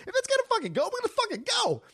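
very high-pitched mock yelling; screechy falsetto outburst, hammed up; high-decibel voice. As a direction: imitate yourself losing it, voice shooting way up. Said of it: "I went into high decibel voice".

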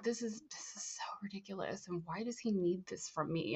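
hushed, retold private complaint; exasperated whisper. Student complaining to herself about the ritual, exasperated whisper.

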